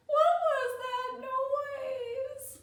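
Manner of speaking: put-on high, drawn-out scared-child wail; frightened wail. Little girl asks grandma about the noise, frightened wail. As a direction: stretch the words out, high and fearful.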